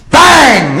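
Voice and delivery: very high, loud "bang"; imitated bang. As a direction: yell out the bang as a sound effect. Louder repeat of the sound.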